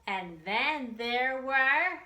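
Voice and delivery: bouncy nursery rhyme read aloud, drawn out before each number; singsong rhyme. Acted. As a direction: chant it for little kids.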